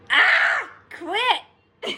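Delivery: high laughing yelp, teary; shouted laugh then a giggled quit. Real, not acted. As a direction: laugh it out, overwhelmed. Laughing while teared up.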